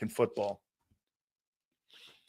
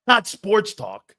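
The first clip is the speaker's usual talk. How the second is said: laughing; 5 semitones higher, 5 dB louder, slower than usual. Real, not acted. Host laughing at caller.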